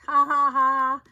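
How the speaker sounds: loud, spaced-out ha-ha-ha; big delighted "ha ha ha"; triumphant laugh. Real, not acted. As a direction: laugh it out in loud separate beats. Laughs after being surprised.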